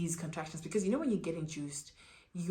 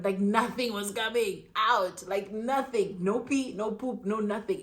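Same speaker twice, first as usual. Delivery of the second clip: usual speaking voice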